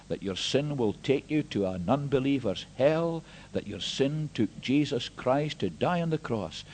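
ordinary talk

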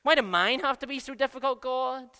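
petulant protest to God; whiny complaint. Retold or imitated. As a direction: complain like a hard-done-by child. Voicing a complaining believer.

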